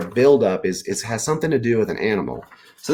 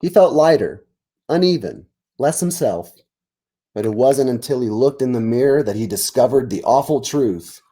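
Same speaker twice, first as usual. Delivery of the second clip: lively read-aloud narration, a touch higher; story narration; 3 semitones higher, 4 dB louder than usual. A narrator's reading. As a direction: read it out like a picture book to kids. Teacher reads story narration.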